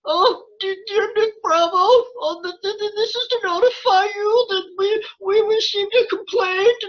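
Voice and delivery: high, whiny stammering cartoon kid; Morty. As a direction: stutter it out like a panicky cartoon teen. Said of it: "Morty from Rick and Morty talking".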